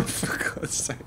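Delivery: unvoiced whisper under a laugh; whispered aside. Real, not acted. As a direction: mutter it off-mic to yourself.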